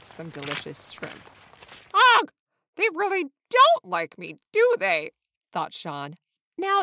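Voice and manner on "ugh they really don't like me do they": loud, high groan of rejection; dramatic, self-pitying complaint; upset wail. A narrator's reading. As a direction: wail it like a hurt young bird.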